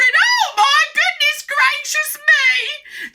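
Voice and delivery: very high, loud, drawn-out horror; hammed-up falsetto shock; shocked exclamation. A narrator's reading. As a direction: cry out as an aghast storybook mum. Mother shocked by what she sees.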